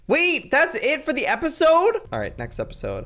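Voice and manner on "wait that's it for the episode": loud, high rising protest; real high, loud disbelief; outraged disbelief. Real, not acted. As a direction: cry out at a cliffhanger. Episode ends on a cliffhanger.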